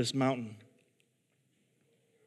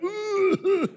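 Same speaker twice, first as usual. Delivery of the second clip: very high imitated winded "ooh"; winded groan; 4 dB louder than usual. Counselor landing on his tailbone, comic high winded moan. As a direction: mimic having the wind knocked out. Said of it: "knocks the wind out of him".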